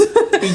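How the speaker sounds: short high laugh that falls away; a quick real laugh; bright laugh. Real, not acted. Host amused at her own retelling, a short high laugh.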